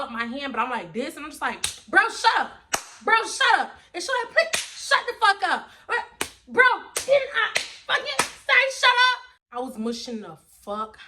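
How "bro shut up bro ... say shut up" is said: higher, loud furious orders to shut up; snapping at the thief to shut up; angry yelling. Retold or imitated. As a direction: bark it at her, fed up.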